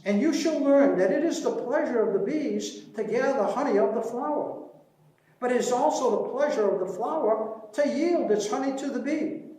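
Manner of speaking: a lightly lifted, measured verse reading; poem recitation. Acted. Beekeeper reciting a bee poem to the audience, lightly lyrical. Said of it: "most beautiful little verses".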